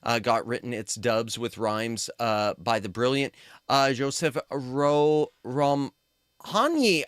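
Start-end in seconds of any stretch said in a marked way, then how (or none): 3.9-7.0 Romhany sounded out slowly, syllable by syllable; careful attempt at a name. Real, not acted. Reading a Hungarian translator's name, slow careful attempt. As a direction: slowly feel out Joseph Romhany. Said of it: "I'm sorry I'm butchering that name".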